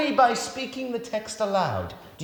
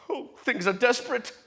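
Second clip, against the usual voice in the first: normal talking voice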